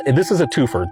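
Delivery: plain reading voice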